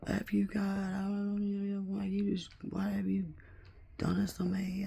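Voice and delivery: high, strained cry up at God in the storm; Captain Dan. Retold or imitated. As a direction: wail at the heavens like a broken soldier. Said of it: "went full Captain Dan on that shit".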